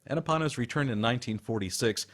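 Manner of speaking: usual speaking voice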